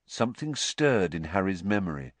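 usual speaking voice

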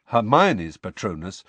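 ordinary delivery

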